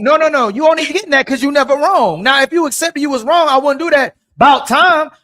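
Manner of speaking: loud, heated insisting. Real, not acted. Arguing with the women, loud and insistent. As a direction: push the point hard over the others.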